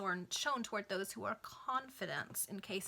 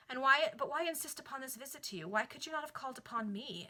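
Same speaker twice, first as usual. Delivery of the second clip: higher, louder impatient demand; 5 semitones higher, 4 dB louder than usual. Acted. Lady Cecilia impatiently pressing Greenwood about the visit. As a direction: press him, wanting answers now. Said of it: "inquired the lady impatiently".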